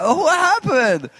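mock dazed wonder; bewildered. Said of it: "I was like".

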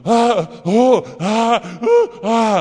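high, imitated sleepy moans; mock moaning in sleep. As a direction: ham up the wife's groans from a bad dream. Pregnant wife moaning in sleep.

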